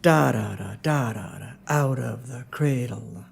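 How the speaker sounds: heavy first "da" and first word "out", light syllables after; low, falling chant, stretching "out of the cradle"; dactylic beat. Shown as a demonstration. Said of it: "But you hear the".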